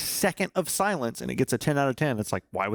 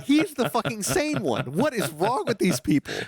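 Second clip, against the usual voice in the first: incredulous; 11 semitones higher than usual. Real, not acted. Incredulous outburst at interviewees.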